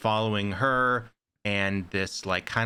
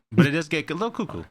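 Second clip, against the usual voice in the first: playful tease; 6 semitones higher, 4 dB louder, much faster than usual. Acted. Playful teasing about the ending.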